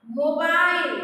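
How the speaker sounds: normal talking voice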